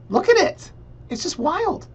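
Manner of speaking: high squeal of disbelief at the listing; shocked squeal. Real, not acted. Realtor reacting to the listing price with a high shocked squeal.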